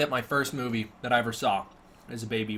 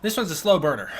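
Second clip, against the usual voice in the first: ordinary talk, nothing marked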